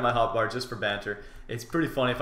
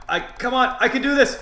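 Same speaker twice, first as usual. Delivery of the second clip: high, strained urging; urgent self-encouragement; 11 semitones higher, 6 dB louder than usual. Real, not acted. Player urging himself through a tough fight, high urgency. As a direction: psych yourself up, voice climbing.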